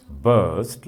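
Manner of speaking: "burst" drawn out with a firm, strong onset; loud stressed syllable. Shown as a demonstration. Teacher showing students a loud syllable, stressed demonstration. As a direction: hit "burst" as one stressed, stretched syllable. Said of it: "loud, na?".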